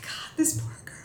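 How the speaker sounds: put-on whispered pity for the girl. Viewer pitying the girl on screen, whispered. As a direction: whisper it, wincing in sympathy. Pitying thought while watching.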